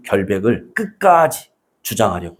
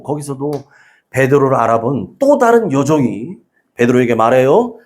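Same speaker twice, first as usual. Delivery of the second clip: ordinary delivery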